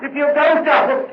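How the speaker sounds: very high, loud, near breaking; on the edge of screaming, plead with everything; hysterical begging. Acted. Murderer breaks down, begs constable.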